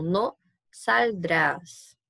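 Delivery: "no saldrás" spelled out very slowly, each syllable separate; slow demo. Shown as a demonstration. Teacher showing the future form, very slow and spelled out. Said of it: "a gente teve que tirar o ir".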